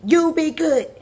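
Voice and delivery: hammed-up husband yelling at his wife; imitating a yell. Imitating the husband yelling at his wife to behave. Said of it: "He's like".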